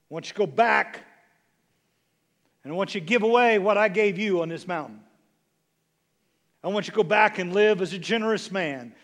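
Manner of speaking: ringing divine charge; forceful commissioning. Acted. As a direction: proclaim each command with building conviction. God commissioning Elijah, acted by preacher.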